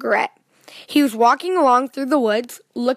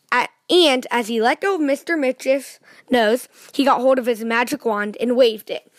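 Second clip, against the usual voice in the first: ordinary delivery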